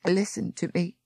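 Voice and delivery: implored. Acted. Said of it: "she implored".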